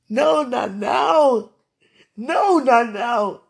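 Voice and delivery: slow, loud, wailing no; anguished shout. Real, not acted. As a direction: shout the refusal through tears. Crying out refusal.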